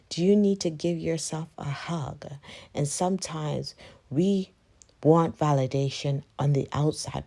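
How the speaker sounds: loud, driving motivational address; rally the listener, full voice; loud pep talk. Acted. Urging viewers to check in, loud rallying speech.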